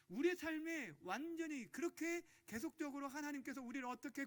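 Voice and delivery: high, forceful sermon voice; preacher building to a loud, high-pitched appeal; impassioned preaching. Real, not acted. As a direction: press it urgently on the congregation.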